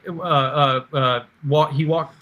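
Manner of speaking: normal talking voice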